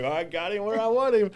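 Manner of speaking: high, sneering villain voice; gloating Satan. Retold or imitated. Satan gloating over the trapped believer, high mocking voice. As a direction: gloat like a devil who has you trapped. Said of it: "just be like".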